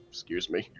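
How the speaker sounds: small, low, held-in voice; soft and low, as if holding down a burp; containing a belch. Acted. Drinker excusing himself after a massive belch, stifled. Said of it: "tries to contain his, like, like, a massive belch".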